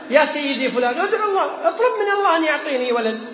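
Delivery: a mocking imitation of a pleading worshipper. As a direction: play the grave worshipper begging, amused. Voicing grave-worshipper's plea.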